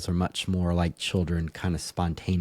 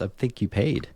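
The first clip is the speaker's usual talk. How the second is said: ordinary delivery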